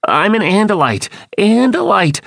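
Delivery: very high, pleading call; urgent cry. A narrator's reading. Andalite pleads with attacking Lyrans, crying out urgently. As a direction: cry out high, insisting on who you are. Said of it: "I cried".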